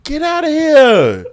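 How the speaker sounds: imitating disbelief. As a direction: replay his reaction, drawn out. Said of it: "that's him".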